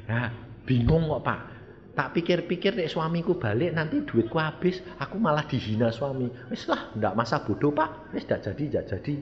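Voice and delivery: low, very soft acted reply; confused woman. Woman backing out of her vow to the pastor, quiet confused excuses. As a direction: mumble excuses in a small, quiet voice. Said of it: "Lalu saya tanya".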